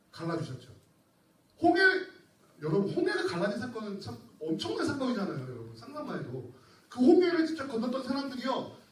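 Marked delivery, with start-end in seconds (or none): none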